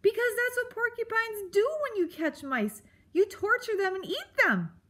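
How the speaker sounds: high, insistent squeak; distressed mouse. A narrator's reading. Mouse explaining why she fears torture, distressed. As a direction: blurt it out in a high frightened voice. Frightened mouse accuses porcupine.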